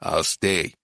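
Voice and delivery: low, grim and understated. A narrator's reading. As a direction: dig in grimly, voice dropped low. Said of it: "said Titus grimly".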